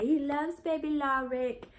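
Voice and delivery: normal talking voice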